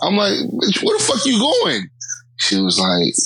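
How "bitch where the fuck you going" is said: very high, drawn-out mock exasperation; exasperated questioning. Man asking his uninvited passenger, exasperated high voice. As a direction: stretch it out in a squeaky retold protest. Acted exchange with the stranger.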